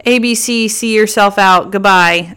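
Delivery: loud, high, sassy brush-off; a hint of diva dismissal, slowing on see yourself out. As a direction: send him packing with attitude. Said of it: "I'm gonna be like".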